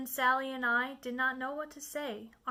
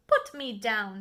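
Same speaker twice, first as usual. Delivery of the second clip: louder firm demand; fish protesting; 3 semitones lower, 7 dB louder than usual. Acted. The fish in the air telling the Cat, loud and firm. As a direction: insist hard on being let down. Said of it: "said the fish".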